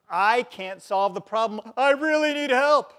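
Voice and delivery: pleading. Acted. Preacher voicing a desperate man begging God for help. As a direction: beg for help, a touch desperate. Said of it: "He's pleading to God".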